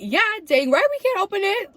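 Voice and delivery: high, loud sarcastic jab. Real, not acted. Mocking her sister's obvious complaint, high and loud. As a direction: throw it back at her, mockingly.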